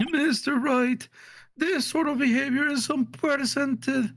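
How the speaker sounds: high, formal character voice; stern court official. Acted. Court official scolding Mister Wright, high stern voice.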